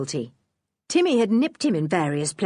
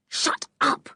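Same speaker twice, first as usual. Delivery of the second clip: breathless voiceless shut-up; fierce hushed snap. Acted. George silencing Anne, fierce and hushed. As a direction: silence her sister sharply. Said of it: "said George fiercely".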